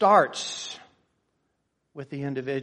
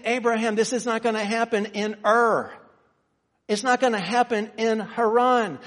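preaching God's command; 7 semitones higher, 6 dB louder than usual. Acted. Preacher voicing God to Abraham, fervent preaching. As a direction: press God's warning home.

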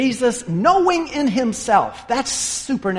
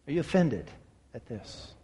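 much softer, ending in a whisper; whispered question; 6 semitones lower, 12 dB quieter than usual. Acted. Preacher repeats Jesus' question to the congregation in a whisper. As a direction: breathe the question out, barely voiced. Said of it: "The reason I emphasize that".

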